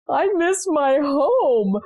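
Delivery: put-on high, slow, tearful little boy's whimper; crying child. Little son in back seat missing home, tearful whimper. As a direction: voice a small son wailing for home. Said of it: "and he's crying".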